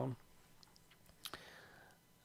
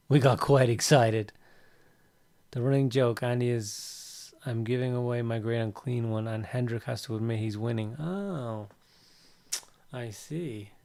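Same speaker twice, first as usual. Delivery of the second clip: ordinary talk, nothing marked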